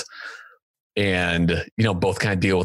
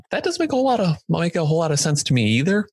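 high mock voice; 9 semitones higher, much faster than usual. Mock confused aside.

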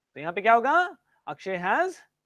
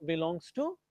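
normal talking voice

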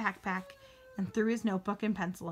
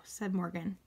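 plain reading voice

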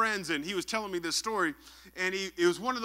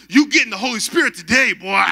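loud imitation of a pressuring pastor; pushy pastor; 11 dB louder than usual. Pastor zoning in on a reluctant man, loud and pushy. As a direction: bellow it like a pastor cornering someone. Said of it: "and the pastor says".

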